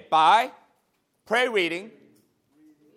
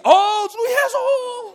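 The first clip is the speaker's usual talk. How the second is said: high shouted call; fervent, very high shout; 8 dB louder, slower than usual. Retold or imitated. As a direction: sisters crying out while cooking. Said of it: "And they're cooking".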